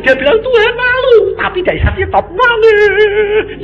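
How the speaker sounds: very high wailing imitation of a sobber; mock childlike crying. Preacher imitating someone crying when filled, hammed-up child's wail. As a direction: bawl the words like a little child. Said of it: "Dia nangis kayak anak kecil".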